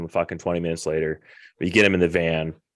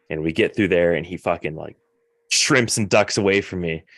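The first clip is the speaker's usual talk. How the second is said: normal talking voice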